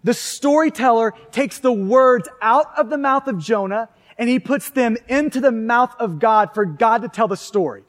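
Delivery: ordinary talk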